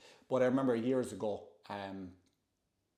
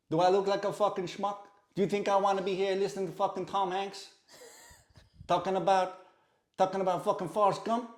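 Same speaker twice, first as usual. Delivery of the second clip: higher, heated New York tough-guy rant; mock-angry De Niro tirade; Robert De Niro impression; 7 semitones higher than usual. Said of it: "And Robert De Niro".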